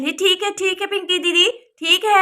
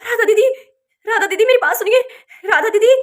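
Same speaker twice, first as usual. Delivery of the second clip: raised, urgent call for help; 5 semitones higher, 6 dB louder than usual. Acted. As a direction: call out in alarm to wake your sister-in-law. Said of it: "Simmi आवाज लगा रही है".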